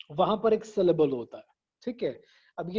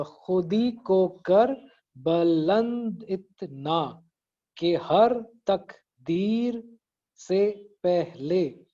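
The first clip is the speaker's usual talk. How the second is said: very slow, each syllable of खुदी को कर बुलंद इतना separated; much slower than usual. Shown as a demonstration. Teacher counting syllables in the first line, syllable by syllable. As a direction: break every word into spaced beats, तक ... दीर. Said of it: "इसमें अभी जरा गिनते हैं".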